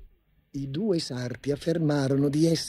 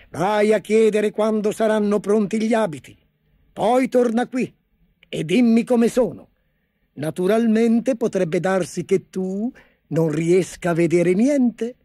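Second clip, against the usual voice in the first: bossy emperor; 5 semitones higher, 4 dB louder than usual. A narrator's reading. Emperor sending the chancellor to the tailors, teasing. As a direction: send him off with a sly threat.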